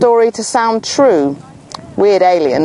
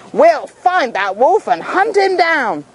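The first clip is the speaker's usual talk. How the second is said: higher, slow determined vow; vengeful crowd; 8 semitones higher than usual. Acted. Townsfolk vowing to hunt the wolf, determined. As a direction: vow it grimly.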